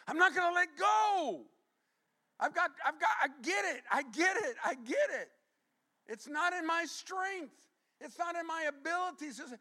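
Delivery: high, loud pleading cry of a clinging man; desperate cry. Retold or imitated. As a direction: cry out, begging not to let go. Jacob's desperate plea, shouted.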